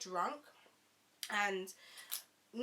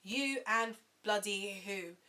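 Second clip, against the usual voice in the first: ordinary delivery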